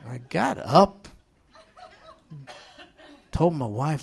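ordinary talk, nothing marked

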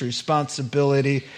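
normal talking voice

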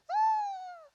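very high-pitched fangirl squeal; high mock scream. Preacher imitating fifteen thousand screaming teenage girls, high squeal. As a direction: imitate a screaming girl in a crowd.

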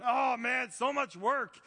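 mock overwhelmed complaint. Acts out his complaining busy answer.

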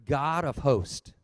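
low, slow prayerful opening. Real, not acted.

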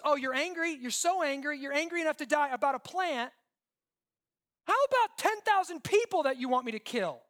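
high, loud indignant questioning; incredulous challenge. Acted. God confronts Jonah over the plant, incredulous.

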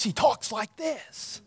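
soft, slow hushed impression of a preacher; Lou Engle voice. Pastor imitating Lou Engle's voice for the congregation, soft and slow. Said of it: "he's got this voice".

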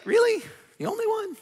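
very high-pitched, doubting questions; mock disbelief, voice lifted sky-high; incredulous God. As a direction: gently needle him with squeaky-high surprise. God doubting Elijah's claim.

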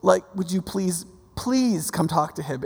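hushed, deferential begging at the start; pleading. Retold or imitated. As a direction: plead meekly, as if for your life. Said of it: "this guy takes a different tack".

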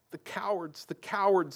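a touch of tearful disgust; sobbing. A narrator's reading. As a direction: lightly sob the words. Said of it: "sobbed Susan".

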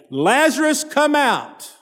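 high ringing call into the tomb; shouted call. A narrator's reading. Jesus calling Lazarus out of the tomb, a high shout. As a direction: call the dead man out, pitched high. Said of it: "Then Jesus shouted".

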